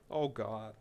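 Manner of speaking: ordinary talk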